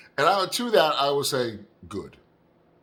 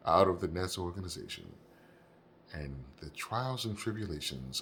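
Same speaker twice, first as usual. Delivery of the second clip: dramatic trailer voiceover; 6 semitones lower, 8 dB quieter than usual. Pretend. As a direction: announce a docudrama gravely, with long pauses. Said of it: "So that's my voiceover".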